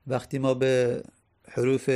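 normal talking voice

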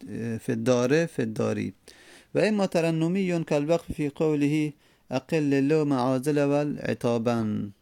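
ordinary talk, nothing marked

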